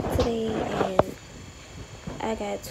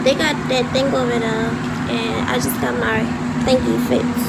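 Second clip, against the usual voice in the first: loud, fast, so the phone hears; talking loud; 8 dB louder than usual. Real, not acted. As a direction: raise the voice over the noise. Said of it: "but I'ma talk loud".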